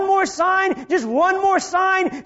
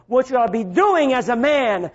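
ordinary talk, nothing marked

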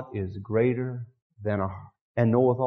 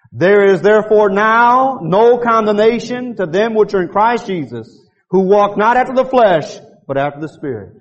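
reading aloud together; 7 semitones higher, 8 dB louder than usual. Shown as a demonstration. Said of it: "Let's say it out loud".

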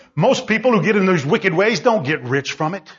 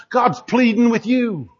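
ordinary delivery

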